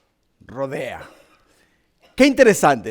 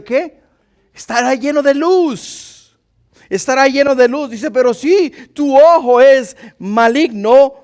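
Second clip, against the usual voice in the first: loud, high fiery preaching; 7 semitones higher, 6 dB louder than usual. Acted. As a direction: preach it out at full force. Preaching scripture at full force.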